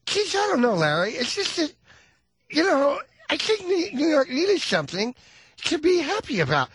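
high, lispy [name] imitation; lisping [name] voice; Liza lisp impression. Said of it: "she's got a lateral lisp on top of everything else".

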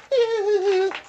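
high-pitched giggle; laughing. Acted. Studio sidekick tickled by the outburst, laughing. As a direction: giggle away at the crude remark. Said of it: "sitting there and laughing about it".

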